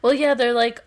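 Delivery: usual speaking voice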